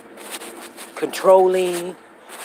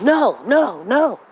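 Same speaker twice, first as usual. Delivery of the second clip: stubborn refusal; 6 semitones higher, 3 dB louder, much slower than usual. Retold or imitated. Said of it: "and then they like".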